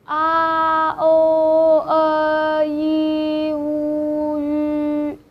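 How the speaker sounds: A, O, E, I, U, U, lips narrowing each step; six vowels in a row. Shown as a demonstration. Teacher demonstrating vowel sequence to watching learners, lips narrowing. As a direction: say the vowels slowly with a shrinking mouth. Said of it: "Look at my mouth".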